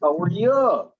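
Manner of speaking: "you" in "How are you" leaned on as a full "yuh"; imitating odd pronunciation. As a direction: lift the last word "you" into a stressed "yuh". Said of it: "doesn't that sound a little funny?".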